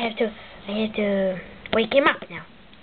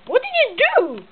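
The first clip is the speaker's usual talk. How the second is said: high, upset question; high-pitched dismay; alarmed outcry; 13 semitones higher than usual. Acted. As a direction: demand it in a high, startled voice. Mario upset at Luigi's attack.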